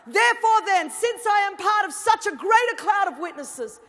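loud, high, driving sermon voice; an impassioned call to the church; full-force preaching. Acted. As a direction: preach it out to the whole hall.

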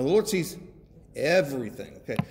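ordinary talk

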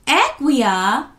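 loud, high scolding call of her name. A narrator's reading. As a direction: tell her off sharply. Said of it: "the rest of the babies scolded".

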